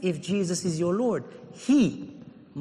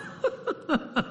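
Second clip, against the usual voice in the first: soft laugh; 9 semitones higher, 7 dB quieter than usual. Real, not acted. Laughs mid-sermon.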